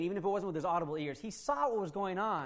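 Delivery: ordinary delivery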